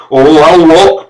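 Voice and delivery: high, loud bawl. A narrator's reading. Servant bawling back at his master. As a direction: yell it back defiantly. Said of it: "bawled Gilmer".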